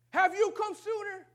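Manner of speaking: high, loud, slow anguished cry of Martha; Martha's grieving reproach. Acted. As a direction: wail it at Jesus like a grieving sister.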